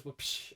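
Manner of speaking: whooshing "psh" sound effect; imitated whip crack. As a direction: mimic the crack opening a theme song. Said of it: "it goes".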